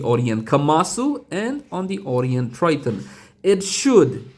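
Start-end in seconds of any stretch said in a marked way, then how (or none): 3.4-4.3 should pushed high and loud; stress on should. Real, not acted. To viewers, hedging his claim, with should stressed high and loud. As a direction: lean hard on the word should. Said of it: "and I emphasize the word should".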